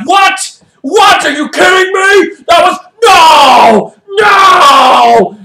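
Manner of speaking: hammed-up outraged shouting, pitched high; mad. Angry Giants protesting the referee's call, mock outrage. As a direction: protest in disbelief, stretching out each "no". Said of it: "The Giants are mad".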